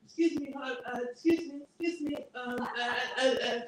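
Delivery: put-on high stammering little kid voice; stuttering kid. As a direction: stammer it out like a small child. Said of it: "stuttering all the time".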